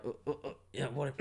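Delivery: soft, stammering imitation of a shifty passenger; imitating shifty hesitation. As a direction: stall nervously, as if caught out. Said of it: "guy's just gone a bit shifty".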